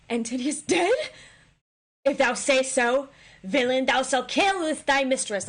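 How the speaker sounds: high, loud alarmed Cleopatra; panicked queen. Acted. Cleopatra threatening the messenger, loud and high-strung. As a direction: demand the truth in a panic. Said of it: "She's like".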